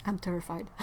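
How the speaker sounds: usual speaking voice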